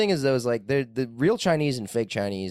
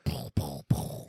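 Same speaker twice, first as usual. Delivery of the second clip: breathy whispered calling; whispered pull; 6 semitones lower, 6 dB quieter, much slower than usual. Retold or imitated. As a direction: hiss the words like a secret call. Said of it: "Sometimes I go like".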